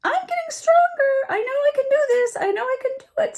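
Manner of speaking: very high, loud, straining little critter; little raccoon voice. A narrator's reading. Little raccoon cheering himself up on the log, high and straining. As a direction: squeak it out like a determined baby raccoon. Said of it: "said the raccoon".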